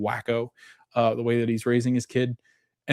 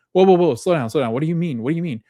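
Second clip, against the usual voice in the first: higher, louder urgent interruption; retold alarm; 6 semitones higher, 5 dB louder, faster than usual. Gabby hears bad news from Donna on the phone, acted alarm. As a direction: act out someone stopping a caller in alarm.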